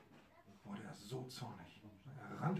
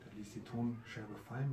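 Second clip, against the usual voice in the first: usual speaking voice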